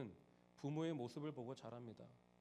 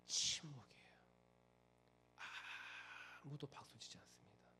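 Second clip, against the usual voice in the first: hushed whisper; 2 semitones lower, 9 dB quieter than usual. A narrator's reading. Narrating the hushed hall.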